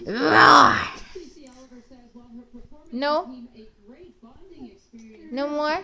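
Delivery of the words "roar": a low play roar that's louder; low, loud playful roar. Shown as a demonstration. Mom showing the baby how to roar, playful loud roar. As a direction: growl it to the baby.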